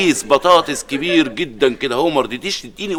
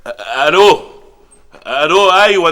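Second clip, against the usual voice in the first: normal talking voice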